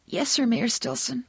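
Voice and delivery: put-on high, small scared-kid voice; frightened. A narrator's reading. Kid with wet cheeks obeying the mayor, frightened. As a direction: answer in a high, cowed little voice.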